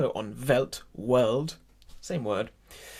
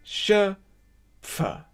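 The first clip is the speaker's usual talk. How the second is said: higher "Schöp", pause, separate "fer"; emphatic; 6 semitones higher, 3 dB louder than usual. Shown as a demonstration. Teacher drills the word for choir, emphatic split "Schöpfer". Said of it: "Really, uh, emphatic".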